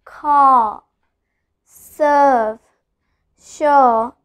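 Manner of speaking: "Car", "serve", "sure" each alone, vowel loud and drawn out; stressed vowels. Shown as a demonstration. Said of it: "I'm stressing the A part".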